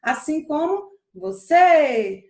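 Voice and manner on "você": "você" with the final "cê" held long and louder; stress on last syllable. Shown as a demonstration. Teacher showing the tonic syllable, final "cê" of "você" drawn out. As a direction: stretch out the "cê" in "você". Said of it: "Onde foi que eu passei mais tempo".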